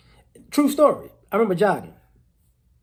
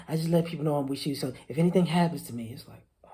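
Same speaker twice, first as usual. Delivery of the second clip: whisper; 5 semitones lower, 5 dB quieter, faster than usual. Retold or imitated. Confiding date's safety plan.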